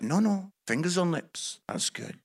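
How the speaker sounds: quiet, calming restraint; God's voice. Acted. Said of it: "But God's like".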